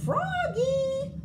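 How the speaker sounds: loud, very high call across the field; teacher calling a wandering kid. A narrator's reading. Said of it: "called Miss Witherspoon".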